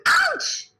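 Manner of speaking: very high, loud yelp of pain; screamed ouch. Retold or imitated. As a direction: yelp it out like you just stepped on a toy. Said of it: "We, we scream".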